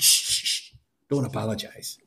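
whispered shushing, very soft; hushing. Acted. King gently hushes the frightened beggar, whispered. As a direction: soothe him in a hush.

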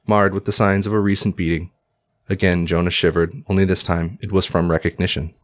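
usual speaking voice

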